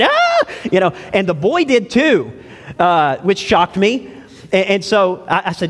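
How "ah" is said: short startled shriek, very high; mock shriek. Pastor mimicking a mom shrieking at worms, high squeal. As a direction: squeal like you just saw a tub of worms. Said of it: "she screamed and jumped back".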